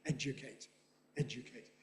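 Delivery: educate as ED-juh-cate, said low and soft; low, quiet demo of educate with a weak middle; schwa in educate. Shown as a demonstration. Lecturer repeating their own form, educate with a schwa. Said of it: "I say educate with a schwa".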